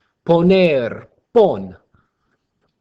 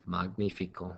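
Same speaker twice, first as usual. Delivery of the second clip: ordinary delivery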